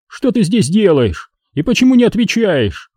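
high-pitched stern questions; scolding. A narrator's reading. Father catches son out at night, scolding. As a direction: demand an explanation from the child.

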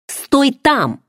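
sharp high shout to stop him. Acted. Heroine on the railing shouts at him to stop. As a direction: cry out high to freeze him. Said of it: "закричала я".

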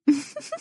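short, high female giggle; light laugh. A narrator's reading. Wife pulling away from her teasing husband, light giggle. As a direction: giggle briefly in character. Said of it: "ضحكت".

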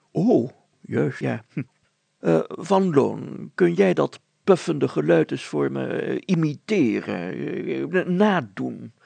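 an official stalling politely; mayor asking awkwardly; fumbling mayor. Acted. Mayor asks to imitate.

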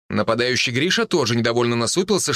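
ordinary delivery

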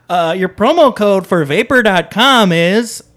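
announcer voice. Acted. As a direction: build up the promo code like an announcer. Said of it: "Let me do that again".

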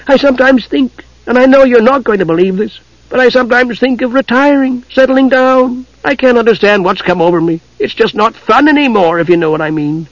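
high-pitched, earnest kender; confiding kender. Acted.

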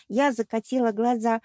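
ordinary delivery

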